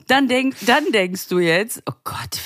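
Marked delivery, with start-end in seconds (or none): 1.9-2.4 whispering. Pretend. Imagined thought of the friend.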